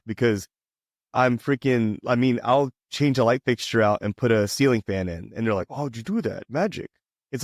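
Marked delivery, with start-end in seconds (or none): 5.7-6.9 hushed, high mock awe; breathless mock wonder at a simple fix; mock amazement. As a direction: play the dazzled city person, softly. Mocking impressed city folks.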